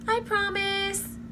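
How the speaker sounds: high slow sweet promise; sing-song promise. Acted.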